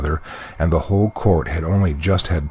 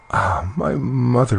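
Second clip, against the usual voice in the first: high, slow, sorrowful sigh of words; plaintive young princess, voice lifted; sad reply; 7 semitones higher than usual. A narrator's reading. Princess answers her weeping mother, sadly. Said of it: "replied Bellissima sadly".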